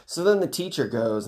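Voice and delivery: ordinary talk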